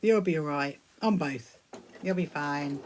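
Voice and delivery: low, softened comforting tone; calm a nervous friend; gentle reassurance. Real, not acted. Reassures viewer scared of surgery.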